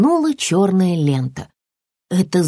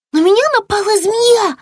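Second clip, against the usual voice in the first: very high, loud, panicked report; frightened wail; 5 dB louder than usual. Acted. Lizard tells mother of the snake, high panicked cry. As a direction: blurt it out high and terrified.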